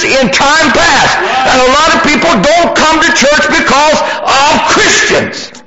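high-pitched, driving pulpit delivery; full-force preaching. Real, not acted.

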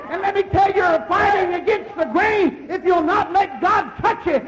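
loud, driving sermon voice building to a shout; fiery preacher calling out to the church; full-force preaching. Acted.

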